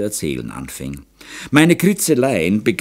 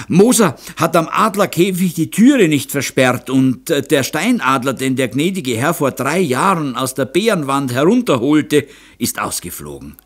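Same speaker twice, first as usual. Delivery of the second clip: high, raised-voice breaking news; excited report; 7 semitones higher, 4 dB louder than usual. A narrator's reading. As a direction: blurt out the alarming news, pitched high. Servant runs over reporting escaped eagle. (aufgeregte, hohe Meldung)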